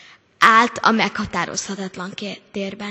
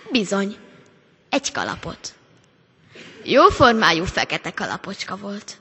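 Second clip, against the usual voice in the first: usual speaking voice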